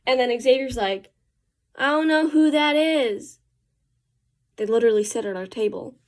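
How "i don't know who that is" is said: lightly voiced clueless reply, higher and slow; mildly hammed-up cluelessness; clueless friend. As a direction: drag it out as a puzzled friend.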